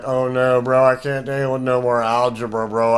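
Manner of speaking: lightly voiced fed-up student; mock complaint. Teacher imitates people turned off by theory, mock complaint. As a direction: imitate a bro who has had enough theory. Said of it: "some people that they're like".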